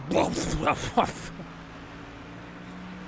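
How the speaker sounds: unvoiced rumbling plof noise; a breathy sputtering rattle, like hitting the kerb; curb impression. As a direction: mimic tires bouncing over a curb. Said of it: "That's my curb impression".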